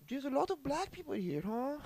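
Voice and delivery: high, thin mock elderly relative; prejudiced relative impression. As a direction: put on a high voice for a tactless remark. Voices a racist grandmother.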